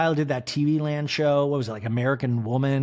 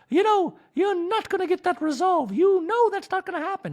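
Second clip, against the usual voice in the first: much higher, louder sing-song wife voice; a doting wife, pitched way up; Dorit impression; 4 dB louder than usual. Wife nagging husband about salad, high mock voice. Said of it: "we have Dorit going".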